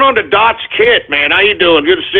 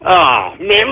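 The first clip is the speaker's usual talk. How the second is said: ordinary talk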